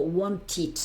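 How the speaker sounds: "want it" lento, con la sílaba final "tid" audible; -ed tras T. Shown as a demonstration. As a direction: alarga "want it" y pronuncia el final como sílaba propia. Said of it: "Want, acaba en T y digo". ("want it" despacio, con "-ed" en sílaba aparte)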